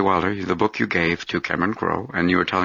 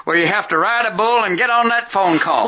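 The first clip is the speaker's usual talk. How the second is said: excited cowboy voice; 14 semitones higher, 3 dB louder than usual. Pretend. High loud mock cowboy.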